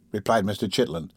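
plain reading voice

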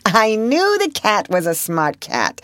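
high-pitched, brittle sarcasm. A narrator's reading. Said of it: "she said in a high voice".

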